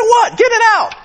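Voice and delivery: high startled shout; hammed-up what, spit it out; mock alarm. Preacher telling a choking brother to spit it out, high shout.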